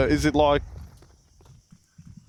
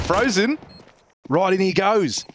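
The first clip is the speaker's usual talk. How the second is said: high-pitched, excited Aussie esports caster; Australian accent play-by-play; 6 semitones higher than usual. Acted. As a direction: call the action in a hammed-up Australian accent. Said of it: "playing in an accent must be very tough".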